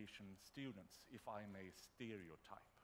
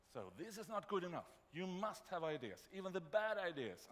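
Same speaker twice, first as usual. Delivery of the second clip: loud, high, demanding yell; yelling at students; 8 semitones higher, 7 dB louder than usual. Shown as a demonstration. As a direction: yell it at the class like a teacher. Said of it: "so I typically yell at them".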